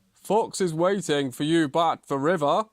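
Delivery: loud, projected bear voice; announcing bear. A narrator's reading. Bear briefs both racers before the start, loud and projected. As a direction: call it out to the racers.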